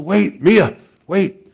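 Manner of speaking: loud, high-pitched call to halt; retold grandpa cutting a child's tirade short; firm interruption. Grandpa halts the crying child.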